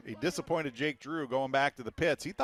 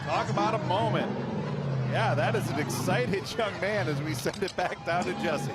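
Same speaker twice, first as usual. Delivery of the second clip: excited; 6 semitones higher than usual. Real, not acted. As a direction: caught up in the celebration. Announcer reacts to winner's celebration.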